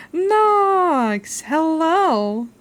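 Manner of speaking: high, loud happy greeting; excited greeting. Real, not acted. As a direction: squeal the welcome. Greeting raider loudly.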